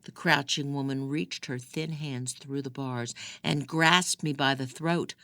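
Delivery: usual speaking voice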